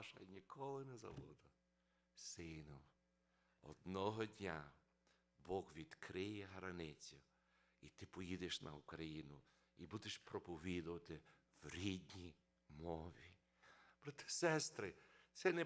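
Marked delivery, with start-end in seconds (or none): 2.1-13.3 solemn father. Retold or imitated. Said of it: "тато мені каже ці слова".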